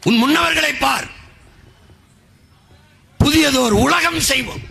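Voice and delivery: high, sustained rallying call; poet's line declaimed. Acted. Orator quotes the revolutionary poet, rallying declamation. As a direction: proclaim it like a summons. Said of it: "புரட்சி பாவலன்".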